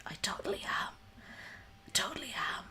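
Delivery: whispered confession; breathy whispered aside. Real, not acted. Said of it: "I'm definitely not staying up".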